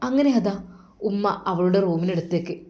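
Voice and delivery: usual speaking voice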